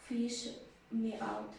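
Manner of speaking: ordinary delivery, nothing marked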